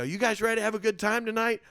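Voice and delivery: high, loud crowd warm-up; stage emcee. Retold or imitated. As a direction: hype a room as the host. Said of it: "walk up on stage and I go".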